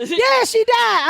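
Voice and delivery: high, drawn-out exasperated answer; high mock-exasperated reply; retold impatient confirmation.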